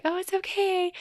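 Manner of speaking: mock bright, high false cheer; fake cheerful. Faking being fine for everyone, in a high falsely cheerful voice. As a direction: chirp it like a forced smile. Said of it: "put on a happy face".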